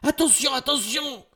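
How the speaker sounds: very high, loud alarm; panicked high yell; screamed warning. A narrator's reading. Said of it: "hurle Valentine".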